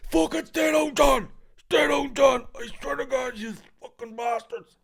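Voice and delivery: high, loud repeated yelling; a man screaming at John to stay down; imitated shout. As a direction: bellow the order over and over. Same man shouting retold.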